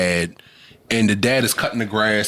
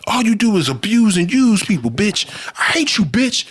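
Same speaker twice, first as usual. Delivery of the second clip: hammed-up furious yelling, much higher; mock raging son; 11 semitones higher than usual. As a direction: scream your hatred at her. Son beating mother, raging.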